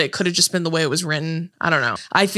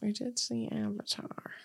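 usual speaking voice

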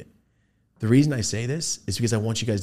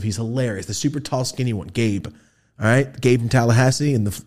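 normal talking voice

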